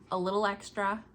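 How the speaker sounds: ordinary delivery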